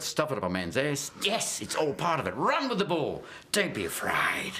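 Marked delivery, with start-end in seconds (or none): none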